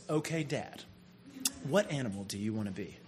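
low, hushed imitation of a child asking Dad; lightly voiced daughter, soft and low; daughter's earnest question. Acts out daughter's earnest question to him.

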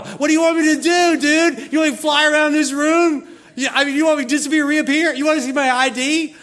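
high, loud indignant rant; hammed-up angel losing patience; exasperated outburst. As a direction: yell incredulous questions at a doubter. Angel exasperated at doubting Zechariah.

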